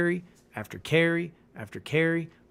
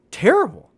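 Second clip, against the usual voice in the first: terrible. jumps higher and louder after a pause; emphatic word; 9 semitones higher, 8 dB louder than usual. Real, not acted. Ending a rant about Gus Edwards, terrible. blurted high and loud. Ranting about a bad running back.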